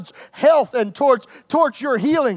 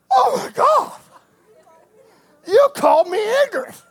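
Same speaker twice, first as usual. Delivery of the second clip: much higher, shrieking offended cry; mock outrage; 10 semitones higher than usual. Church member shocked at being called ignorant, shrieking. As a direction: squeal like you've been insulted. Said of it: "member offended at preacher".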